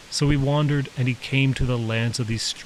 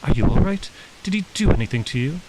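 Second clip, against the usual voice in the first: worried mother; 6 semitones higher, 5 dB louder, faster than usual. A narrator's reading. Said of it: "spoke just loud enough".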